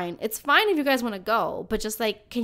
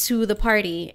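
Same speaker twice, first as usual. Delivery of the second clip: ordinary talk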